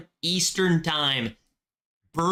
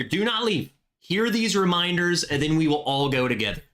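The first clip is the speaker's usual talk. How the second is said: usual speaking voice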